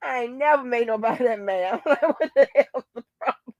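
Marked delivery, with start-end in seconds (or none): none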